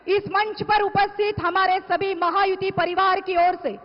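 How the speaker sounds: usual speaking voice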